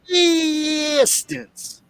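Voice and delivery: high, hammed-up Southern imitation; mock Southern accent. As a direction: stretch DISTANCE out high in a mocking Southern accent. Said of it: "What happened to your voice?".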